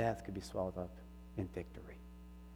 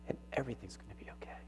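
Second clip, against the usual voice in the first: "everything's gonna be okay" breathed in a soft whisper; whispered reassurance; 9 dB quieter, much faster than usual. Real, not acted. Pastor whispers comfort to the congregation, tender whisper.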